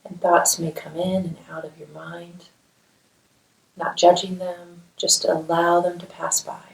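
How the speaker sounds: calm, measured meditation voice with long pauses; guided meditation. Acted. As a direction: guide them gently through a body scan, leaving space to breathe. Leads a guided mindfulness meditation.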